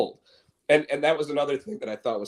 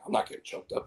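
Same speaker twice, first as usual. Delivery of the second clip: hushed denial; 8 dB quieter, faster than usual. Retold or imitated. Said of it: "He's like".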